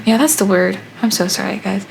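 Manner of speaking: normal talking voice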